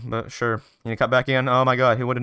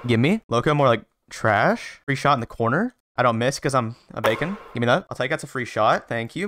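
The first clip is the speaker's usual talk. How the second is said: ordinary talk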